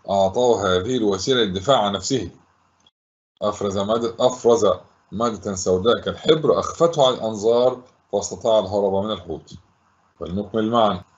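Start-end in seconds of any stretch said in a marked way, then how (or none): 3.4-9.5 lower, quicker run through the answer; brisker recap. Shown as a demonstration. Teacher recapping the answer for pupils, brisk and low. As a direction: repeat it matter-of-factly, lower and brisker.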